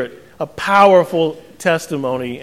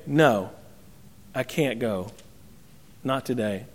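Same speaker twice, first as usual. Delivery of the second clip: low, much softer reluctant refusal; hesitant no; 6 semitones lower, 11 dB quieter than usual. Acted. First son quietly and reluctantly turning down his father. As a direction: back away quietly from the job.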